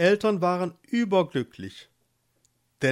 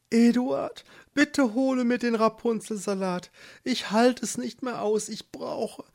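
higher, pleading woman's voice; begging wife; 9 semitones higher than usual. Acted. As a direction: beg him, barely holding on. Wife begs for the herb.

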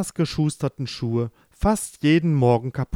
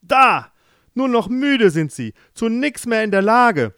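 angry king; 10 semitones higher, 7 dB louder than usual. Acted. King even more irritated.

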